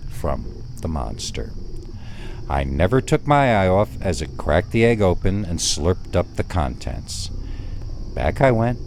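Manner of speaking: ordinary delivery, nothing marked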